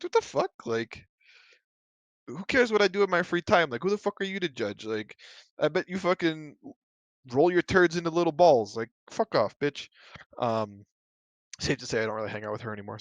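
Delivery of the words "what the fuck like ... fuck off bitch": mock outraged comeback. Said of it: "I was like".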